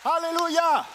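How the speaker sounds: high, loud ringing cry of praise; shouted hallelujah. Real, not acted. Preacher calling out hallelujah to the crowd. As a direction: lift the church with a shout.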